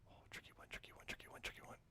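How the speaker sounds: soft playful whisper to himself. Real, not acted. Teacher muses to the kids, whispering. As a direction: breathe the words under your breath. Teacher teasing a hard question.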